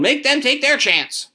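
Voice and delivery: very high, drawn-out yell; yelled protest. A narrator's reading. Wife demanding others draw too, yelled protest. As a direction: wail the demand at high pitch. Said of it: "Missus Hutchinson yelled".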